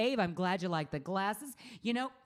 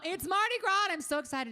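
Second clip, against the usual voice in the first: excited; 11 semitones higher, faster than usual. Real, not acted. Mardi Gras excitement.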